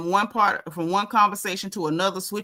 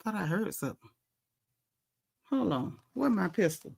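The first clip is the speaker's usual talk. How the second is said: soft, wary aside about a noise; hushed, checking for an intruder; 3 semitones lower, 9 dB quieter than usual. Real, not acted. Vlogger hushed, listening for a noise at home. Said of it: "aside checking a noise".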